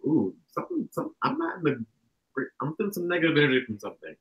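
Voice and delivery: uneasy sensing. Acted. Speaker acts out feeling bad energy, uneasy. Acting out sensing a psychic attack.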